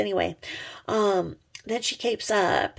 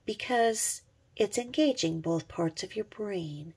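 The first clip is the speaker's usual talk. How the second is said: ordinary talk, nothing marked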